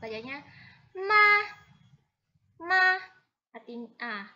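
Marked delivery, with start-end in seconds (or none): 2.6-3.0 ma loud, high and level; first tone ma. Shown as a demonstration. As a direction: hold ma high. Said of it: "nada pertama punya ma". (ma dengan nada pertama)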